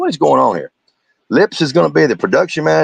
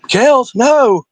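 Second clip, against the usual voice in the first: high-pitched, hammed-up horror; mock dismay; 12 semitones higher, 4 dB louder than usual. Host mocking a streamer's bad news, mock dismay. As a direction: fake a shocked cry in a high voice.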